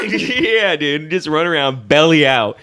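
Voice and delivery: ordinary talk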